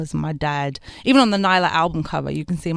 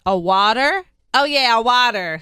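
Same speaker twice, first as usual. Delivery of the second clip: put-on American WATER, higher and louder; American twang; 5 semitones higher, 7 dB louder than usual. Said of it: "I just created this twang".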